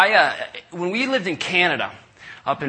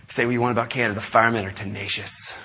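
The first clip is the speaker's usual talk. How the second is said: ordinary talk, nothing marked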